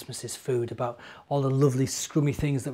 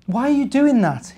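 a lightly voiced little bear, raised high in question; curious little bear; 11 semitones higher, 10 dB louder than usual. Little bear asking the busy ant, lightly voiced curious bear. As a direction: ask it as a curious young bear.

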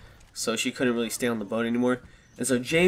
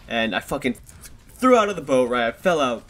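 ordinary delivery

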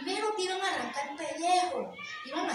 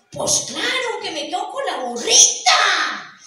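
greedy shoemaker; 3 semitones higher, 7 dB louder than usual. Acted. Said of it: "el zapatero se le quedó mirando y le dijo".

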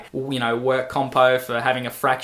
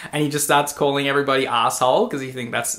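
ordinary delivery

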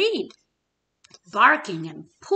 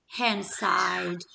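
normal talking voice

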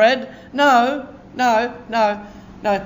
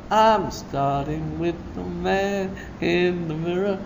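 much softer, hushed repeat of the line; soft echo; 4 semitones lower, 9 dB quieter than usual. Acted. Preacher echoing his own line, softly.

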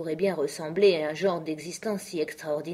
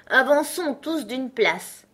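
high, loud, drawn-out demand; interrupting Hatter; 7 semitones higher, 4 dB louder, slower than usual. A narrator's reading. Hatter demanding a clean cup, loudly interrupting. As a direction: cut in with a slow, high voice. Hatter orders everyone along.

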